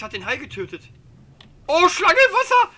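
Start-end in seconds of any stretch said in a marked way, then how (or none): 1.7-2.7 very high screaming laugh; panicked scream. Real, not acted. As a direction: shriek the warning. Snake spotted in water.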